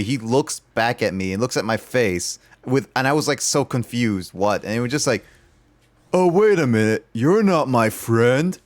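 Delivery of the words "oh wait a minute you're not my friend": loud sudden realization, then slow; oh wait, you're not him; surprised drunk. Retold or imitated. Drunk stranger realizing mistake.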